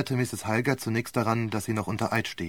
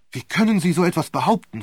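higher, louder protest cutting in; angry outburst; 6 semitones higher, 7 dB louder, faster than usual. Acted. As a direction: burst in, outraged. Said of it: "fuhr der Angeklagte dazwischen".

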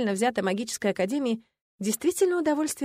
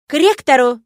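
loud, high command; furious marching order; barked order; 4 semitones higher, 11 dB louder than usual. A narrator's reading. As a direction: bellow the order like an angry dean. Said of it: "гаркнула магистр Шарти".

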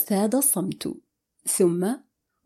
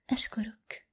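hushed, emotional thanks; moved; 4 dB quieter than usual. A narrator's reading. She thanks him at the funeral, moved and hushed. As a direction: thank softly, with understated emotion. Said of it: "وقالت بصوت متأثر".

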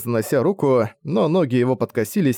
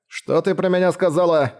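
indignant shout; 6 semitones higher than usual. A narrator's reading. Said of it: "возмутился издалека Куэнс".

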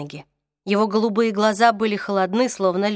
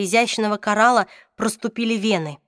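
ordinary delivery, nothing marked

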